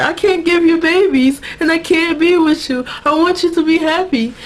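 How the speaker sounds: high-pitched, hammed-up lovelorn wail; mock boo-hoo. Bill tells Sookie he can't be with her, mock boo-hoo. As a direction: whine it like a tearful breakup. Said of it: "they go into this boo-hoo".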